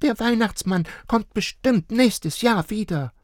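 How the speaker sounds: put-on high child voice cheering. A narrator's reading. Little girl cheering up her parents, high and sweet. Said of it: "versuchte Luca".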